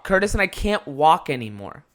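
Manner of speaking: ordinary talk, nothing marked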